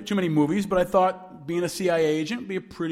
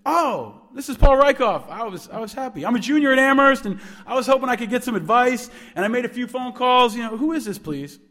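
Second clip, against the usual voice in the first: put-on higher-pitched, rambling young caller; eager, flustered student; 5 semitones higher than usual. Student explaining himself to angry alum, flustered.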